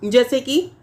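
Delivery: ordinary delivery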